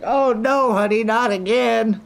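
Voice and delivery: high exasperated wife voice; mock wife. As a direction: play the weary wife, hammed up. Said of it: "You guys like".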